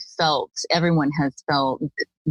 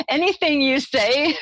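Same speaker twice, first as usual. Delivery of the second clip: higher, laughing through the words; 10 semitones higher than usual. Real, not acted. Host teasing her friend about the rage story, laughing. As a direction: say it while cracking up.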